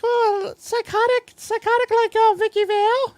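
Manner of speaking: high squeaky put-on voice; high mock voice describing a psychotic cat; mock crazy voice. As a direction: ham it up like a cartoon crazy.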